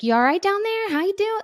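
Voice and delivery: high, friendly check-in call. Retold or imitated. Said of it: "She was like".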